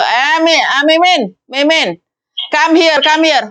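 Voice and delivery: high calling out to the maid; employer calling the maid. Retold or imitated. Madam calls her maid across the house, high. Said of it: "gak mungkin kan diceluk".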